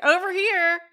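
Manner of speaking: loud, high playful call; mock calling out. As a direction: imitate hollering from across the store. Imagined shoppers calling out.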